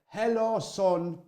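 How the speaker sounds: put-on an old Jamaican man miming each word to a boy; miming elderly Jamaican man. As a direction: spell it out slowly like talking to a child who can't understand. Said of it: "elderly Jamaican men would mime to me".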